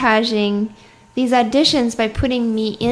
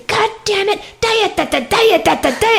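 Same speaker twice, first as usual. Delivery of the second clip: imitated rant in a raised pitch, rattled-off nonsense syllables; mock swearing teacher; 9 semitones higher than usual. Student imitates their old clarinet teacher swearing mid-playing, mock-angry. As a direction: play-act a cursing teacher without real volume. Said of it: "and just swear at me".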